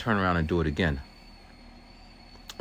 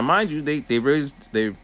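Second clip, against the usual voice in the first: ordinary talk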